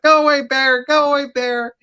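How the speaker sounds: high put-on voice, loud hollering at the bear; hunter yelling at bear. Friend on his buddy's shoulders scaring the bear, high hammed-up yell. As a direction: play the stacked hunter bellowing to look big. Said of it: "he's like".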